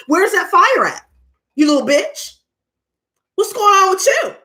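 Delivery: rubbing his face in it, rising in pitch; taunting yell. Retold or imitated. Taunting neighbor in retold fight.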